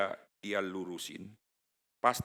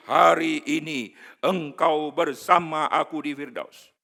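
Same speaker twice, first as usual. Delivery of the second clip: solemn promise; 6 semitones higher, 11 dB louder than usual. Acted. Quoting Jesus' promise.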